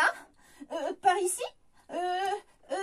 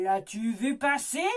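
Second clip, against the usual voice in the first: witch voice; 6 semitones lower than usual. Acted. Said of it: "Lance la sorcière".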